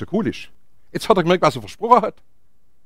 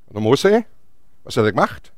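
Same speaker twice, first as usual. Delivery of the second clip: usual speaking voice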